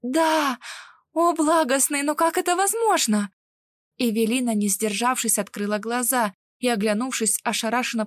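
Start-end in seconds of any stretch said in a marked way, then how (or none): 0.0-3.3 high, breathtaken exclamation rising to the question; astonished and thrilled; amazed excitement. A narrator's reading. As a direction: cry out in wonder, pitched well up. Astonished heroine answers on seeing threads.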